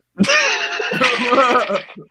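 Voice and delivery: high cackling laugh; evil chuckle. Real, not acted. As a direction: squeal it out like a villain. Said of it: "You got the anime laugh".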